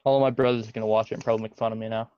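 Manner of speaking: ordinary delivery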